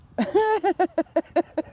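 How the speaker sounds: long, high cackle of laughter. Real, not acted. Laughing fit.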